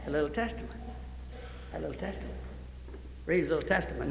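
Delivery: hushed. Acted. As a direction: drop to a near hush. Preacher hushes over the boy's testament.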